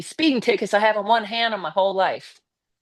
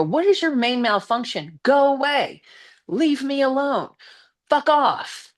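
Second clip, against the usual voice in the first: high, raised voice telling him off; angry telling-off; 8 semitones higher than usual. Retold or imitated. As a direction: yell it at the guy who cornered you, building up. Telling off the other driver.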